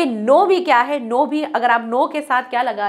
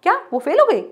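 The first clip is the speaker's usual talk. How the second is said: higher shocked question; surprised; 6 semitones higher, faster than usual. Shown as a demonstration. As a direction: gasp it out in disbelief. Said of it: "surprise दिखाने के लिए".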